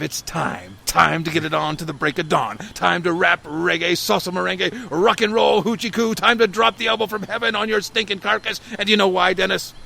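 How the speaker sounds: loud, high wrestler promo, building up; wrestling promo. Acted. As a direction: cut a cocky wrestler promo. Wrestler cuts his catchphrase promo.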